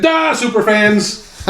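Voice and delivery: a slow, drawn-out light Chicago Duh, Superfans; Chicago accent. Retold or imitated. Host quoting a listener's answer, slow light Chicago accent. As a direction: slowly ham up the Chicago Superfans catchphrase. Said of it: "And he said".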